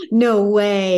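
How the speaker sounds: loud delighted disbelief; surprised. Real, not acted. Host reacts to the pregnancy reveal, loudly surprised. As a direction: blurt it out in shock.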